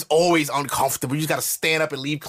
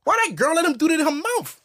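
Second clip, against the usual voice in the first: high, squealing disgust; grossed-out boy; 12 semitones higher than usual. Retold or imitated. Shocked boy reacts to his first time. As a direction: recoil like a shocked teen.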